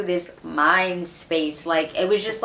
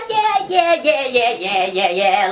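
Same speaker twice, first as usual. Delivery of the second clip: eager yeahs; 4 semitones higher, slower than usual. Retold or imitated. Eager agreement to interview.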